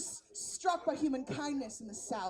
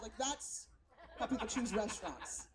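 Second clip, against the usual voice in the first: usual speaking voice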